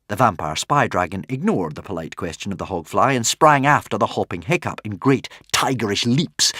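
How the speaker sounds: usual speaking voice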